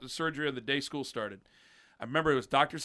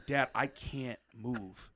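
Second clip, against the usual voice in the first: weak plea; 7 dB quieter, much slower than usual. Retold or imitated. Retelling the plea to dad.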